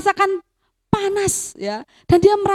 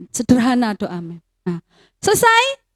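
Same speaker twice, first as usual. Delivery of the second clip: normal talking voice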